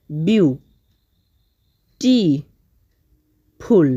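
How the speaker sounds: বিউ, টি, ফুল as three parts with long pauses; syllable by syllable. Shown as a demonstration. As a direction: break the word into বিউ... টি... ফুল. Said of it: "আমরা এখানে syllable ভাগ করে করে পড়লাম".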